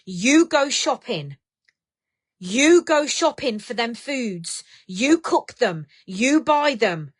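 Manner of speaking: slow, each phrase hammered home; telling a viewer to own her eating; slow firm lecture. Real, not acted. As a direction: lay out the facts one by one. Livestream host scolding viewer Lorraine.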